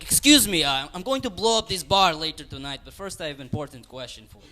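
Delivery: high, loud mock Russian terrorist voice; Russian accent. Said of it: "this is a Russian terrorist that I'm gonna do".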